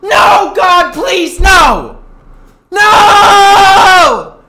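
high, loud pleading scream of no; Michael Scott's no. Retold or imitated. Streamer reenacting his despair as Michael Scott, screaming and pleading. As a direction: beg the heavens like a sitcom boss. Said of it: "I felt like Michael Scott".

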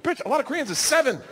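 high-pitched, escalating outrage; exasperated rant. Acted. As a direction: rant at your wife in high-voiced disbelief. Acted rant at wife.

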